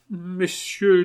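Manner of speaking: high, stately address; cold dignity. Acted. Marquise rising before the brothers, high and dignified. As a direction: address them formally, on your dignity. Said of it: "avec une froide dignité".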